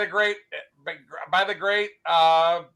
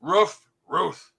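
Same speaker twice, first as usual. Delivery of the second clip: barking dog; 7 dB louder, slower than usual. Acted. Replaying the dog's barked punchlines.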